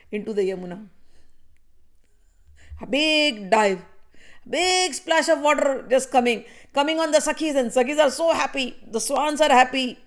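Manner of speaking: usual speaking voice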